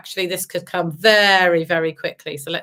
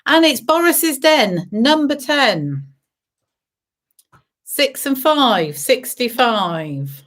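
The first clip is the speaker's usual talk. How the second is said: sing-song number calling; bingo caller; 3 semitones higher, much slower than usual. Acted. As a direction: call the numbers like a bingo host. Bingo calls with rhymes.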